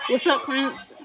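ordinary talk

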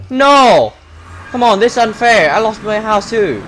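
upset protest. Real, not acted. Protesting the griefing.

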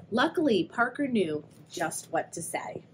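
ordinary delivery

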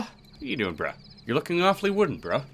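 normal talking voice

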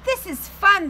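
high, loud happy cry; excited animals. Acted. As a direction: shout it with glee. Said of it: "they all said".

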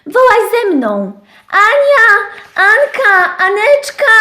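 dużo wyżej i głośniej; głośne wołanie. Acted. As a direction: krzyknij na cały głos Anię. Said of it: "wołałam naprawdę głośno". (owieczka woła Anię z misiem, wysoko i głośno)

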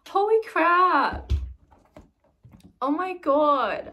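loud, high, drawn-out exclamation; amazed. Real, not acted. Overwhelmed by friend's gift, loud slow amazement. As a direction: stretch it out in awe.

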